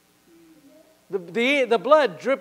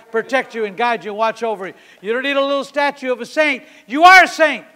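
raised, driving preaching voice; 2 semitones higher, 7 dB louder than usual. Acted. Preacher assuring congregation their angels are with them, driving exhortation. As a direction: exhort the crowd, building to a loud peak.

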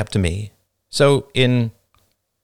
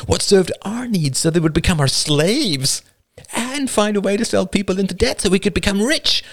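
higher, giddy villainous scheming; gleeful villain; 9 semitones higher than usual. A narrator's reading. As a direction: relish every evil idea. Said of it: "Emily gasped".

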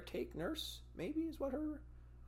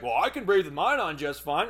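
louder, smug comeback; snide retort; 5 semitones higher, 9 dB louder than usual. Retold or imitated. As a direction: imitate the nurse being condescending. Nurse's snide reply.

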